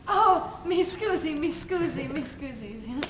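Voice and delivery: put-on Italian accent. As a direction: apologise over and over as an Italian stranger. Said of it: "Italian guy walks in".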